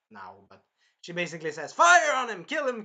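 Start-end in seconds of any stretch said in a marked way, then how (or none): 1.7-2.8 high, loud urgent commands; shouted battle order. Retold or imitated. Melty ordering troops onto the enemy, shouted. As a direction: yell orders to attack.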